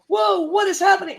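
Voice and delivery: high, loud startled outburst; stunned surprise. Retold or imitated. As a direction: blurt it out, caught completely off guard. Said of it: "he was like".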